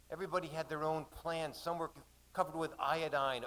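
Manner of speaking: plain reading voice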